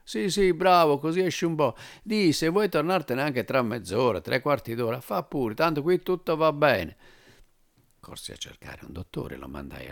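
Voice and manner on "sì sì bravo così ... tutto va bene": high, loud, jovial dismissal; the major, jovial. Acted. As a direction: wave him off cheerfully. Major dismisses him kindly.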